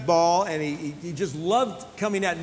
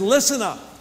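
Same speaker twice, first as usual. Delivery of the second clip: commanding call; 5 semitones higher, 5 dB louder than usual. Retold or imitated. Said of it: "He said".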